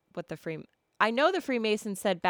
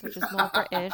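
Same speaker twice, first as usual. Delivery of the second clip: mock Australian accent. Shown as a demonstration. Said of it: "my Australian accent sounds kinda like this".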